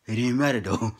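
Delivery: ordinary talk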